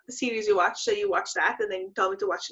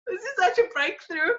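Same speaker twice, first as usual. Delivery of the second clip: mock tearful; 8 semitones higher than usual. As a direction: wail it tearfully, half laughing. Recalling a moving film scene.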